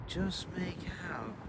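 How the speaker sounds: hushed whisper. Real, not acted. Vlogger whispering while filming the woods. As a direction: breathe the words to keep quiet.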